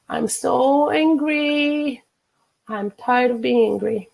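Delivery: slow, stretched tapping phrase; drawn-out affirmation. Shown as a demonstration. Coach models a tapping phrase for viewers, slow and drawn out. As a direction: draw out each word, lingering on "so angry".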